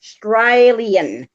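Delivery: slow, drawn out "Australian" with a long ay sound: strey-lee-en; Australian pronunciation. Shown as a demonstration. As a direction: stretch "Australian" out slowly, saying strey-lee-en. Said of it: "I'm Australian".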